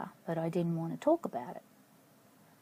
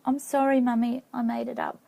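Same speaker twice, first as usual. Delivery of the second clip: put-on little girl apologizing; 5 semitones higher, 4 dB louder than usual. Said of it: "I actually said".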